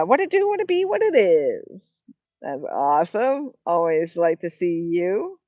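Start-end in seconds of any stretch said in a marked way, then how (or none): 0.0-1.8 sail up high and stretch the last word; playful catchphrase greeting. Real, not acted. Streamer hailing a viewer in the chat, playful high catchphrase.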